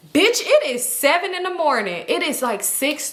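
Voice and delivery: high, exasperated disbelief; you cannot be serious; incredulous. Real, not acted. Incredulous aside to audience.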